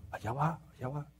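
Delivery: soft, sly gossiping aside; hushed gossip. Retold or imitated. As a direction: say it behind their back, softly. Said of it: "Pácala del barrio". (chisme en voz baja)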